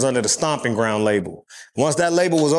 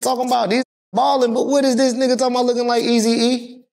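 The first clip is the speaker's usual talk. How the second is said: mocking Atlanta locals; 10 semitones higher than usual. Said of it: "they like".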